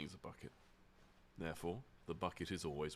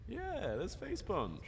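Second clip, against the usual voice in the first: playful; 13 semitones higher, slower than usual. Real, not acted. Playful drawn-out remark.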